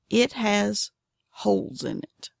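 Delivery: measured teaser with a pause before "holes"; deliberate repeat. Real, not acted. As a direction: draw the words out for emphasis. Teaser repeated slowly for emphasis.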